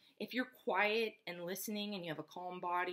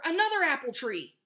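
high, loud excited discovery; farmer's cry; 6 semitones higher, 7 dB louder than usual. A narrator's reading. Farmer spotting another apple tree, excited cry. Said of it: "cried the farmer".

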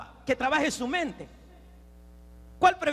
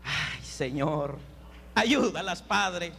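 exasperated prayer; 5 semitones lower, much slower than usual. Acted. Preacher asks God to help the women, exasperated prayer. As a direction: sink into a weary plea.

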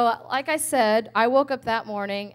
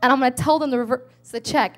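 heated outburst; 2 semitones higher, 7 dB louder than usual. Retold or imitated. Threatening to call the ministry.

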